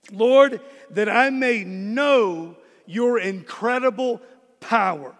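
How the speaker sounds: drawn out, each word weighed; slow, deliberate prayer line; slow prayerful read. Shown as a demonstration. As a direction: pray it slowly. Prayer verse read slowly, then repeated.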